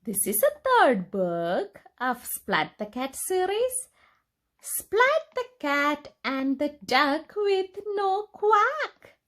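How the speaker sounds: plain reading voice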